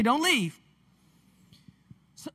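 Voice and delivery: ordinary talk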